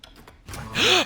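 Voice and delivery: sharp frightened gasp; startled gasp. Real, not acted. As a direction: gasp at a jump scare. Jump scare in horror game.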